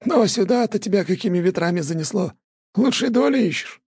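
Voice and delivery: put-on high, teasing old grandpa; an old man prodding with curiosity. A narrator's reading. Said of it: "дедок". (голос дедушки)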